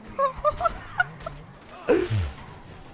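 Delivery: high shrieking burst of laughter; real giddy laughter; squealing laugh. Real, not acted. Laughing at his own trick.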